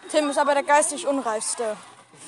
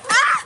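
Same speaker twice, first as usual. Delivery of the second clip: loud high yelp; startled yelp; 12 semitones higher, 7 dB louder than usual. Real, not acted. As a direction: yelp in surprise. Yelp as chair falls.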